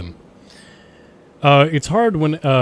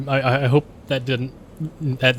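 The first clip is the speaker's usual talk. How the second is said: normal talking voice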